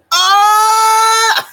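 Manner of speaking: sudden high yelp of surprise; startled shout. Real, not acted. Streamer yelps at a sudden alert, high and loud.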